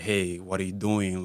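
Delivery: normal talking voice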